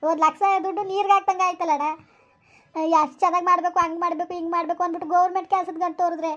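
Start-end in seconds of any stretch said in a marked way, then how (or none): none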